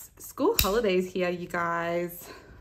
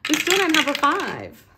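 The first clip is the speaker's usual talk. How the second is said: exasperated; 7 semitones higher, 8 dB louder than usual. Real, not acted. Dice never rolls five.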